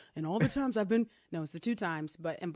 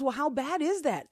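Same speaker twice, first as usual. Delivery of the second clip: put-on curious kid; 9 semitones higher, 4 dB louder than usual. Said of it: "And she goes".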